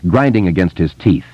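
usual speaking voice

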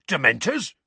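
rising, disbelieving question; aghast. A narrator's reading. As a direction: echo the word in shocked disbelief.

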